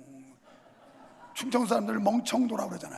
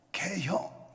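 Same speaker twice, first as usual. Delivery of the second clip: Chungcheong dialect; 4 semitones lower, 6 dB quieter, much slower than usual. Retold or imitated. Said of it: "간단한 한마디".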